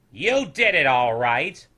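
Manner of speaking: slow, loud scornful drawl; mock his effort; sarcastic snoodles. A narrator's reading. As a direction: townsfolk snoodles mocking his painting. Snoodles sneering reply.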